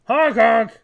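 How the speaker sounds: loud, high clown honk; silly clown. Acted. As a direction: blare it like a clown horn. Clown taunt louder.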